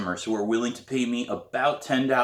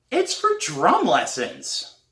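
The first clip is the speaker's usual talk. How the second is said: put-on young girl; 9 semitones higher, 4 dB louder, much slower than usual. Girl answering old woman.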